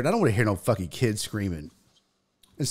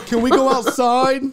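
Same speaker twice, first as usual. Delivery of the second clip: put-on high, louder small child's plea; child asking his dad; imitated kid; 11 semitones higher, 6 dB louder than usual. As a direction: ask meekly to leave. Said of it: "and he goes".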